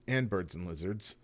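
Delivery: usual speaking voice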